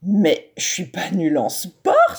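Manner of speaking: put-on slow, indignant boy's protest; a new kid defending himself; bristling protest. A narrator's reading. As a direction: push back, stung. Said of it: "se rebiffe Hippolyte".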